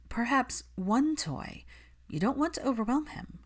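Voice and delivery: patience wearing thin; measured restraint. Acted. Hermione reining Catherine in.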